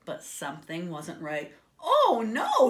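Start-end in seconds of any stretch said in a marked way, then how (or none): 1.8-2.7 high, loud cry of dismay. A narrator's reading. Pig seeing his creation has wings, alarmed cry. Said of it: "he cried".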